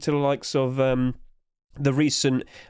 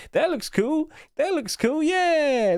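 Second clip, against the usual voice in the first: very high, squeaky mock enthusiasm; squeaky silly voice; 13 semitones higher, slower than usual. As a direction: squeak it out like a cartoon.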